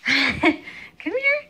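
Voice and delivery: high coaxing call; sing-song calling. Real, not acted. Mom calling baby again, high coaxing call. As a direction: call the little one over.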